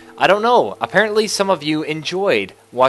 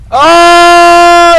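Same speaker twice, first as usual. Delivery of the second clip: long high "oh" of dismay; drawn-out high, loud cry; loud wail; 16 dB louder than usual. Real, not acted. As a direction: wail loudly in frustration. Car fails to work.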